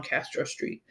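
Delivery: breathy whispered aside "Castro Street". Shown as a demonstration. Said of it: "whispered repeat of Castro Street".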